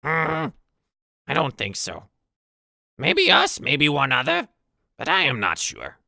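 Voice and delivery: high, loud goblin voice. Acted. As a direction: think aloud in a high goblin squawk. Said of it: "goblin kinda looks around and goes".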